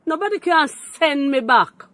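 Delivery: defiant outburst; loud defiance. Real, not acted. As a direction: raise the voice to win the point. Heated call-in argument.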